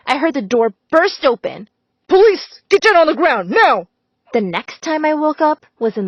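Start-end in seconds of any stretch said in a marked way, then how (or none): 2.1-3.9 much higher, louder command; police shout. A narrator's reading. Police officer storming the house, loud commanding shout. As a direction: bark orders bursting through the door.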